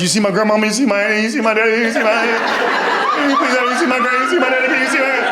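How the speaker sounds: high, laughing, nagging questions; pestering ghosts. Acted. Dead people pestering God.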